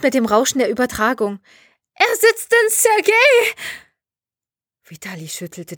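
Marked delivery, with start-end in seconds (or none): 1.9-3.5 drawn-out, very high shriek; shrill scream. A narrator's reading. Jelena screams what she found in Sergei, shrill. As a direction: scream each word slowly in horror. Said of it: "schrillte die Stimme in der Leitung".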